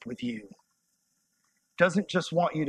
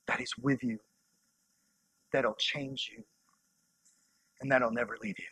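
much softer and lower, gentle; soft and tender; 5 semitones lower, 7 dB quieter than usual. Real, not acted. Preacher reassuring young listeners, soft and low. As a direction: reassure them quietly, one phrase at a time.

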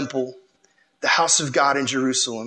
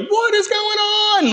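much higher, slow, shocked cry; startled voice cracking upward; slower than usual. Retold or imitated. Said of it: "you'd be like".

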